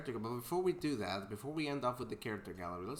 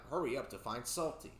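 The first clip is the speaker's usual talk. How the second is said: ordinary delivery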